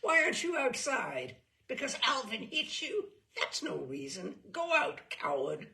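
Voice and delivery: harsh, loud scolding teacher's rasp. A narrator's reading. Nursery teacher scolding a boy hiding in the corner, loud harsh rasp. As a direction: bark it at the cowering little boy. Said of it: "her rasp".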